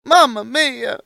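put-on very high, loud Italian accent; Italian exclamation. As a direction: belt it like a cartoon Italian. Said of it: "I tried to do the Italian accent".

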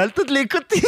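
high-pitched imitated protest; mock indignation. Imitating the hipster on the bike, high mock indignation. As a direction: mimic an indignant cyclist, pitched right up.

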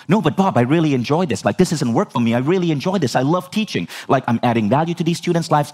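retold eager protest, high pitched; justifying. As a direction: plead your case in an unbroken rush of reasons. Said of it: "I'm trying to justify".

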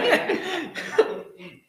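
loud high-pitched laughter. Real, not acted. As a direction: crack up laughing.

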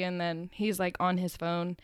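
ordinary delivery

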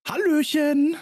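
high bright sing-song hello; cheery greeting. Real, not acted. Podcast greeting call-out.

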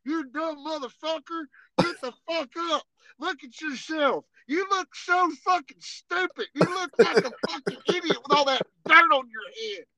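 mock drill instructor chewing someone out; megaphone instructor. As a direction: berate a trainee as if over a megaphone. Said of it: "Some guy on a megaphone".

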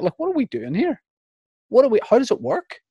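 high, baffled questions; bewildered panic. Retold or imitated. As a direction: sound lost and alarmed. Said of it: "The whole time I was like".

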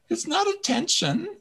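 "It's not attention" squealed very high and shrill. Retold or imitated. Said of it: "I'm gonna get my shrill voice".

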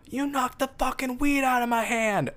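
high, put-on angry man's voice; a hammed-up, indignant stoner shout, pitched high; mock yelling stranger. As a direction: imitate the guy hollering accusingly down the road. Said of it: "I hear him go".

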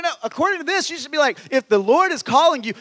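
ordinary talk

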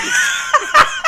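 loud high shrieking laugh; laughing scream. Real, not acted. Laughing at failed voice.

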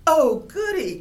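high, loud gleeful cheer; delighted cry. A narrator's reading. Bear cries out happily at the falling rain. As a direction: cheer with pure glee. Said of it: "cried Bear".

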